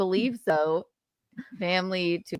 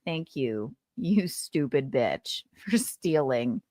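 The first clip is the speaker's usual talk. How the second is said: low and quiet, slow, through a laugh; mock laughing snipe; 6 semitones lower, 4 dB quieter, slower than usual. Daughter voices the winner sniping while laughing. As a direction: mutter it behind a fake smile. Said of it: "She's laughing like".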